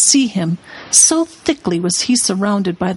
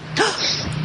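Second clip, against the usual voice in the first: high breathy gasp; shocked gasp; 13 semitones higher, 6 dB quieter than usual. A narrator's reading. Lucy seeing Aslan mocked, sharp gasp. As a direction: catch the breath in horror.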